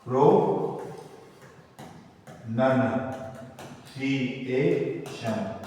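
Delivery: "प्रोनंसिएशन" very slowly, split into pro, nun, si, ay, shun; syllable by syllable. Shown as a demonstration. Teacher spelling out the new topic for students, "प्रोनंसिएशन" slowly syllable by syllable. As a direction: stretch "प्रोनंसिएशन" with a pause after each syllable. Teacher sounds out word for writing.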